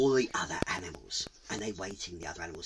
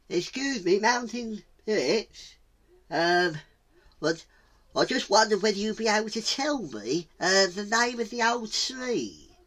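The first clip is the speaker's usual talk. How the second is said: high, halting, polite voice; high-pitched tentative plea; timid tortoise; 8 semitones higher than usual. Acted. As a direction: ask hesitantly, stretching phrases out. Timid tortoise asks the spirits.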